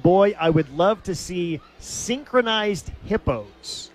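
slow, composed quote with spaced-out punchline; slow, deliberate retelling. Retold or imitated. Announcer retrying a game show host's quip, slow and deliberate. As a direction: hold it together and stretch out "synchronized hippos". Second try at the quip after cracking up.

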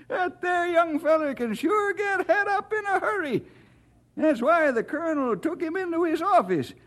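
put-on high-pitched, chatty old codger; folksy old-timer. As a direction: gossip fondly in a high rustic old-timer's voice.

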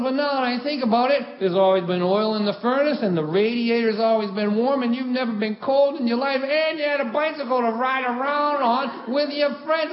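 high, building dad tirade; guilt-tripping dad. Retold or imitated. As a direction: imitate a father piling up grievances without a breath. Dad's guilt trip builds.

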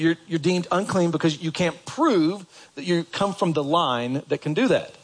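normal talking voice

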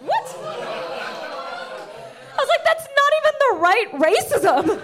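смех сквозь изумление, выше обычного; ошарашенно, со смехом; incredulous laughing. Retold or imitated.

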